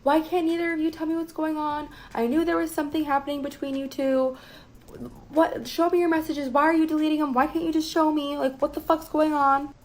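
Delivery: demanding answers from the two of them in a high-pitched mock scream; retold screaming. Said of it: "started screaming".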